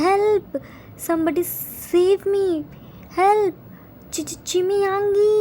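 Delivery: high-pitched, stammering plea; crying for help. A narrator's reading. Beaten student calling for someone to save him, panicked crying out. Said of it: "started crying loudly and screaming".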